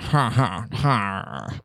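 stilted, halting ha ha ha; Ian Malcolm awkward laugh. Retold or imitated. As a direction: copy a movie character's awkward laugh. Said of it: "that really awkward laugh in the helicopter where he's like".

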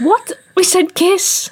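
high shocked outcry. Real, not acted. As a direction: squeak it out in disbelief. Shocked at friend's answer.

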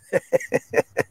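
airy high-pitched laugh; quick wheezy laugh; high breathy giggle. Real, not acted. Laughing at himself.

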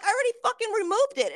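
high-pitched exasperated protest; Rick. Acted. Coworker protests the false glass error, high-pitched. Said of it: "He's like".

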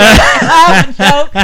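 laughing, slow, nearly choking. Real, not acted. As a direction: laugh it out with a full mouth. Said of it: "I had food in my mouth".

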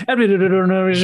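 rapid-fire mock ad babble; fastest talker. Co-host imitating the old toy ad, rapid-fire. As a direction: imitate a speed-talking pitchman. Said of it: "for the fastest talker".

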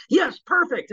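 high-pitched, gushing mock producer; delighted approval. Producers approving the actor's voice, high and delighted. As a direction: approve eagerly, voice jumping up high.

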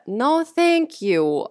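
slow, firm no thank you; emphatic refusal. Real, not acted. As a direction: decline it slowly and firmly. Refusing to share score.

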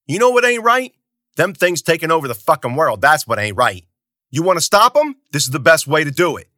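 loud, high furious comeback; angry retort. Acted. Zoot shouting back at Bruno, loud high anger. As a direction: snap back hard.

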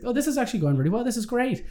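a quick giddy rush of thought; thinking the flirting is going well; excited. Retold or imitated. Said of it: "and then I was like".

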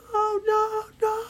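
very high, thin mock cry; muffled warning cry. Amy warns friends who have earplugs in, high muffled cry. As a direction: warn them in a tiny far-off squeal. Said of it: "but it was too quiet".

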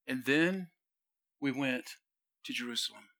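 low, hushed, halting recollection; serious, subdued. Acted. Peter by the dying fire recalling Jerusalem, serious and subdued. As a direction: let the passion drop away, pausing between phrases. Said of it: "passion drops and the voice gets more serious".

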